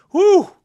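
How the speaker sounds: loud high whistling exhale; sharp exhale of amazement; whew. Real, not acted. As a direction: blow out a big impressed whew. Exhaling after hyping a player.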